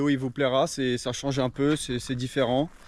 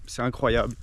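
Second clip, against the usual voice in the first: normal talking voice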